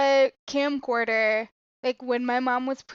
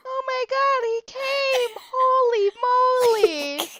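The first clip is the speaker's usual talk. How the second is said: re-enacting a kid's joy, much higher; excited little kid; 11 semitones higher, 4 dB louder than usual. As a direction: gush over the presents like a kid. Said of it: "of course I had to be like".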